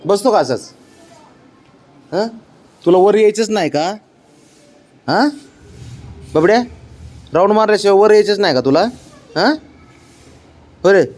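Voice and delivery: quick coaxing questions to a stubborn pet. Real, not acted. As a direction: raise the pitch and plead with the dog.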